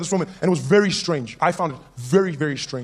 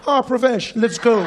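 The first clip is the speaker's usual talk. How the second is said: high, loud call to fight; eager challenge; 7 semitones higher, 5 dB louder, slower than usual. Acted. Comic picks Pravesh from the line-up, loudly eager. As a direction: pounce on the easy opponent with relish.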